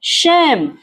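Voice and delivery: शेम drawn out as one syllable, ending on M; silent E. Shown as a demonstration. Tutor models the word for pupils, E silent. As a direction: say शेम slowly with no final vowel. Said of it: "इसे पढ़ेंगे शेम".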